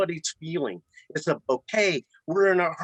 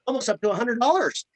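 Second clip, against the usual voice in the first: excited; 9 semitones higher than usual. Real, not acted. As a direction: proudly lift the figure up high. Bragging about average order size.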